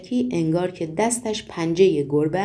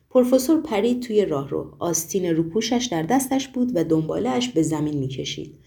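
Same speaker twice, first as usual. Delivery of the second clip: usual speaking voice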